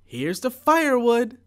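high loud shout. A narrator's reading. Jack bringing firewood to his mother, a high loud shout. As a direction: call it out proudly. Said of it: "shouted Jack".

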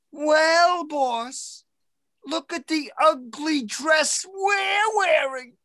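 high, slow mock whine stressing we're; mocking boss's voice. Tom mimicking his boss to mock her dress, high and slow. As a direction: throw her whine right back at her.

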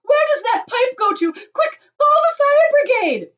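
high, very loud frantic shrieking; panicked yell. A narrator's reading. Mother demands fire brigade, panicked yell. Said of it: "yelled Missus Gloop".

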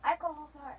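ordinary talk, nothing marked

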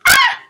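short, very high loud squeal; excited scream. Real, not acted. Streamer reacting to angel helmets, excited scream. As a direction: let out a piercing burst of delight.

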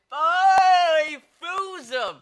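loud, high surprised cry; surprised commentator. Pretend. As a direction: gasp at the trick play.

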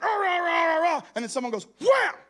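hammed-up brawl noises; mock trash talk. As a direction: bark the taunts, then blast the hit. Acting trash talk then a punch.

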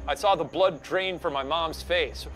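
ordinary talk, nothing marked

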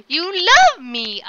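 gasp, then loud, high, drawn-out delight; high, slow, overjoyed exclamation; delighted outburst. Acted. Player to a game character who likes the cat, delighted.

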